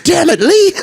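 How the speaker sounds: very high-pitched comic film impression; Chris Tucker. Joining in a quoted film scene, squeaky high impression. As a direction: squeal it in mock exasperation. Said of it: "He's like".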